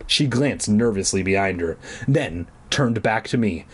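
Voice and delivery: ordinary talk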